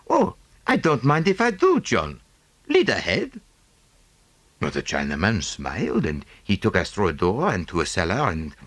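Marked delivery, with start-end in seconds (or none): 0.1-3.4 higher, brighter English gent; lightly voiced hearty sailor; Pearson's voice. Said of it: "and he said".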